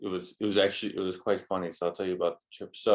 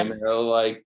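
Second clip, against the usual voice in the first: ordinary talk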